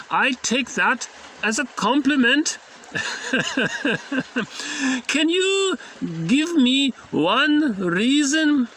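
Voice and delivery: slow, high mock German accent. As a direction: do the analyst with a playful German accent. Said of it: "I don't know if he has a German accent, but just for fun".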